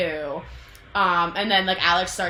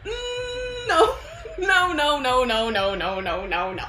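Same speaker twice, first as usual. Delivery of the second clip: string of no's sliding from very high down to usual pitch; hammed-up refusal; 9 semitones higher, slower than usual. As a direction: start squealing, then let the refusals tumble downward. Alex's refusal acted out, hammed up.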